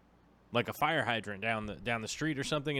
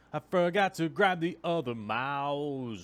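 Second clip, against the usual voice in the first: loud, drawn-out higher call; playful yelling; 4 semitones higher, 4 dB louder, slower than usual. Pretend. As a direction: yell it slowly, stretching each word. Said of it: "I was just yelling".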